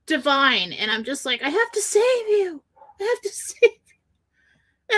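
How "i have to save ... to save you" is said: high, mock-heroic plea with a laugh; mock dramatic plea. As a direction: play the saviour, half laughing. Quotes herself, high, laughing.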